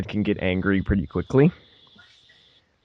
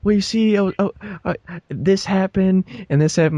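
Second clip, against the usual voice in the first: higher, stammering excuses; flustered stepsister; 11 semitones higher than usual. Retold or imitated. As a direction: scramble for an excuse to Dad. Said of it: "she's like".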